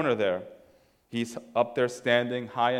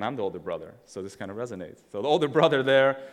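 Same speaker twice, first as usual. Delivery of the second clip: usual speaking voice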